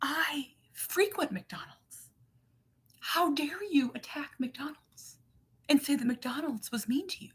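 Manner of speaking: high, hushed, prissy whisper of an offended churchgoer; mock offended whisper. Mom mocking the offended emailer in a whisper. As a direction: breathe it like a scandalized gossip. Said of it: "And her being like".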